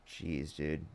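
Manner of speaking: normal talking voice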